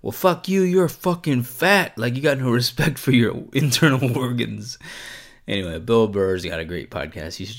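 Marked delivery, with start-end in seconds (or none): 0.0-4.8 loud, high retort, easing off; mock angry comeback. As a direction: fire back as an imitated comic. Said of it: "was like".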